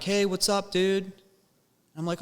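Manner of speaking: high-pitched mock greeting; friendly greeting. Shopper spotting his buddy with a cart, friendly greeting. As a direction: imitate hailing a buddy, voice lifted high.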